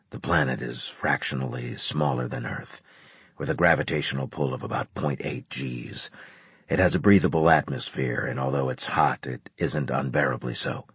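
low, quiet officer voice; brief the officers low and measured; grave commander briefing. A narrator's reading.

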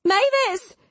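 high, panicked call; crying out. A narrator's reading. Imogen calling for Mama in panic, crying out. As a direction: call upstairs in a panic, pitch way up. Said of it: "she cried".

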